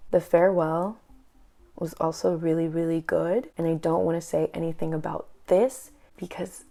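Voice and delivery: ordinary talk, nothing marked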